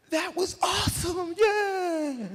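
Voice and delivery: high, slow stretched whoop; thrilled cheer. Retold or imitated. Speaker surfacing to his friends, high elated cheer.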